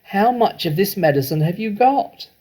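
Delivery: high, urgent and loud; a greedy farmer asking about the medicine; eager question. A narrator's reading. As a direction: press him for the answer. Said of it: "Mister Cranky shouted".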